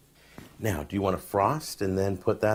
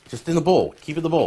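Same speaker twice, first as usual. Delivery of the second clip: urgent raised warning; 8 semitones higher, 7 dB louder, faster than usual. Real, not acted. Urging the kid not to spill.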